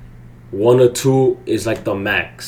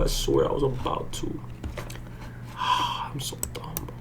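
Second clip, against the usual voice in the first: defeated mutter; 3 semitones higher, 13 dB quieter than usual. Real, not acted. Sinks after the loss.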